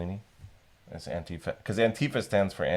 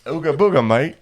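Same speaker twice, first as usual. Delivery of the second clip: loud, higher hammed-up British accent; 7 semitones higher, 8 dB louder than usual. Performing on request for friend, hammed-up British accent. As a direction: belt it out as a cartoon cockney. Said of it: "please say "Ooga Booga" with a British accent".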